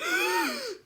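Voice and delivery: very high, loud tattletale gasp. Acted. May catches Junie B. talking, a loud high gasp. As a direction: gasp in shocked outrage. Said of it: "May did a loud gasp".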